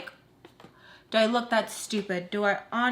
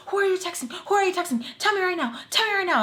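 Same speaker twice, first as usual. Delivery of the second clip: high-pitched, rapid-fire mock interrogation; frantic demanding; 9 semitones higher than usual. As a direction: imitate someone badgering in a high voice. Acts Sam frantically demanding during fight.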